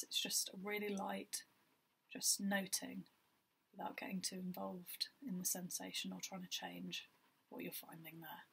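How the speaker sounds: even, unhurried meditation guide with long pauses; guided meditation. Acted. Guiding listeners through a body scan, calm meditation voice. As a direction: lead gently in short phrases, leaving space between them.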